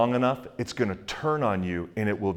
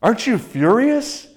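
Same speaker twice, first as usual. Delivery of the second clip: a pitched-up, alarmed voice for the scared courier; nervous courier; 11 semitones higher, 5 dB louder than usual. Retold or imitated. Scared courier asking Alexander about the bill, high alarmed question. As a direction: squeak it out as the frightened messenger. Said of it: "the courier's like".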